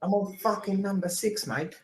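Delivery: mock Aussie accent. Listing fighters for a friend, hammed-up Aussie accent. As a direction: ham up the Aussie accent for the ranking. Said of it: "we gotta do the accent".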